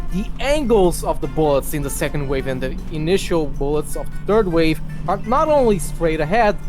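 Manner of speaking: usual speaking voice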